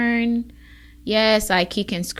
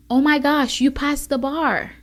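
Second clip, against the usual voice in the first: excited mentor; 7 semitones higher, 5 dB louder than usual. Retold or imitated. Mentor congratulating her after exam.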